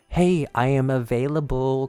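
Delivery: normal talking voice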